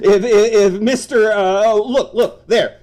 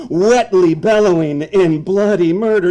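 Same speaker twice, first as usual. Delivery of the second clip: normal talking voice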